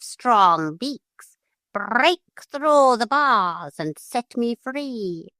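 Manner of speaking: high, commanding parrot, slowing at the end; a bird giving orders. A narrator's reading. Parrot commands the gulls to free it, high and slowing.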